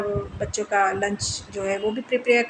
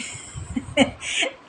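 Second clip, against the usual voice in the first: short quiet giggle; brief, softer real giggle; 4 semitones higher, 5 dB quieter than usual. Real, not acted. Vlogger recalling a subscriber's comment, short giggle.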